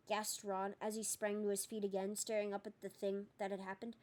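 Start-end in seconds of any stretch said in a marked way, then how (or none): none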